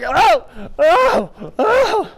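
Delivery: rising, loud alarmed oh's; alarmed cries. Retold or imitated. Rider spun around on the horse, crying out in alarm. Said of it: "I was like".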